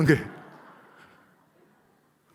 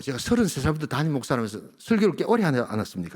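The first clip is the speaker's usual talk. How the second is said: normal talking voice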